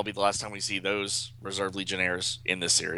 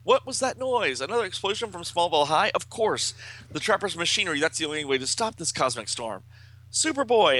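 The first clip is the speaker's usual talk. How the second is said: high, excited comic-book dialogue; urgent realization in a raised pitch; alarmed hero; 8 semitones higher than usual. A narrator's reading. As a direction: exclaim in alarm, ending on a high call. Voices alarmed comic-book characters.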